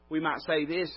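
ordinary talk, nothing marked

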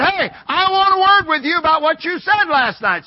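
loud, high challenge from an imitated objector; confrontational demand. Man confronts the preacher again.

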